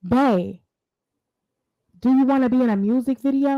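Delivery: put-on quick, breezy clueless boyfriend voice; lightly voiced clueless boyfriend; mock casual boyfriend. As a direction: toss it off like nothing's wrong. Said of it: "The man called the next day and said".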